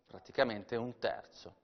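normal talking voice

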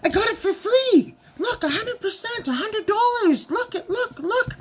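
put-on high, eager man-child voice; excited husband. Wife mimics husband bragging, high excited. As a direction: squeal it like an overexcited kid husband.

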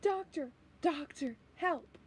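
high, faint, pleading call; distant cry for help. Acted. Companion in danger calling the doctor, frightened cry for help. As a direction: call for rescue from far away.

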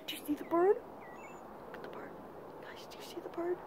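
very soft, barely voiced whisper; hushed whisper. Real, not acted. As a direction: whisper so the bird isn't disturbed. Quietly pointing out a bird.

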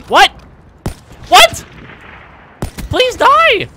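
very high, loud frantic yell; panicked shout. Real, not acted. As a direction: scream it in panic.

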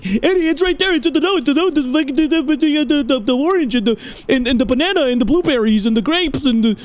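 high, frantic, stammering mimicry; yelling commenters. Viewers telling Eddie where the clue is, mock exasperation. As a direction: imitate exasperated viewers tripping over their words. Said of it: "I know you were yelling in the comments".

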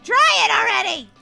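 high, loud impatient order; exasperated yell. Acted. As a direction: shout at a useless machine. Frustrated at the dryer.

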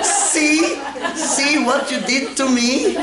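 high, slow whiny complaint; mock wife voice. Husband mimics his wife blaming him, high whiny complaint. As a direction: whine it like the accusing wife.

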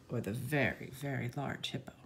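low, soft giant voice; big voice. Acted. Voicing large hippo size.

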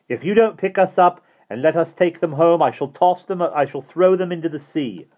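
put-on high, loud child threat; defiant shout. A narrator's reading. Barney threatens Mister Hastings with the Grail, shouting. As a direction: shout the ultimatum across the rocks.

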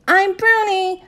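high, loud singsong announcement; joyful shout. A narrator's reading. Girl announces her horse name, joyful shout. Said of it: "I shouted".

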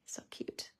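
a hushed, admiring whisper. Real, not acted. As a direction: breathe it out in a whisper. Admiring card quietly.

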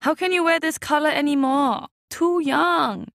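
high, loud scolding; scolding aunt. A narrator's reading. Auntie Lindo scolding mother about the red sweater, high. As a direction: scold her like a fussy elder. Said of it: "she scolded".

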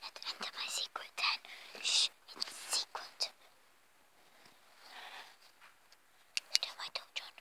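hushed secretive whisper. Pretend. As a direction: whisper like sneaking into a hideout. Secret den entrance.

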